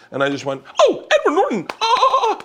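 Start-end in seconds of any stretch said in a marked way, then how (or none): 0.8-1.7 very high, starstruck squeal; squealed, overexcited fan cry; uncontrolled fan outburst. Retold or imitated. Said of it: "I could not control myself". 1.8-2.4 very high-pitched excited shriek; dolphin-like squeal. Retold or imitated. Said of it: "Like a dolphin".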